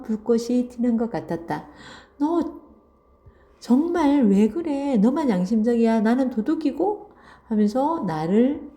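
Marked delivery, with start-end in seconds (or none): 2.2-7.1 higher-pitched, mildly acted protest; mock indignation. Jiyeon resentfully accuses her friend, mildly acted indignation. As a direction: voice an upset friend with raised pitch, lightly. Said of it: "더욱 화가 나는 모양이었다".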